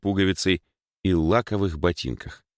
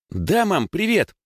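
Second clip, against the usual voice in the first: put-on very high, happy girl on the phone; cheerful squeak; 12 semitones higher, slower than usual. A narrator's reading. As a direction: squeak a merry hello to mum. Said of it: "весело запищала она в трубку". (весело пища)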